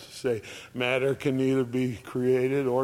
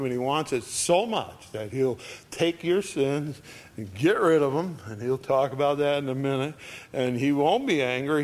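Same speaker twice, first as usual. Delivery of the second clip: ordinary delivery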